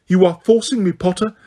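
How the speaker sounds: put-on blaming the boy for what she will do; restless Umbridge. A narrator's reading. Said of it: "said Umbridge, still moving restlessly".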